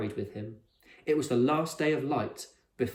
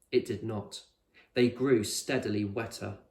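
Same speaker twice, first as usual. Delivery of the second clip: usual speaking voice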